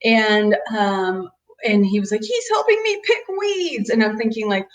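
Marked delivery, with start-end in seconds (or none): 2.2-3.9 put-on high little kid's complaint; a hurt child tattling on his brother; upset six-year-old. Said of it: "my six-year-old was crying".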